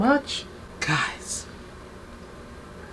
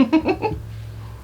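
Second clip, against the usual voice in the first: excited giggle; 12 semitones higher than usual. Real, not acted. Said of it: "I'm so excited".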